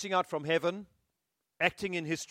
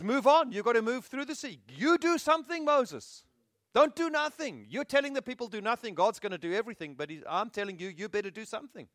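much higher, louder preaching command; God charging Moses; 6 semitones higher, 4 dB louder than usual. Acted. God demands Moses act at the sea, higher and louder. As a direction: drive Moses forward, raised and insistent.

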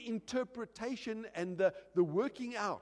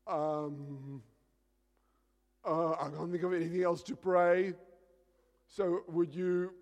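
imitated halting prayer; 3 semitones lower than usual. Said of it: "I hear the prayer".

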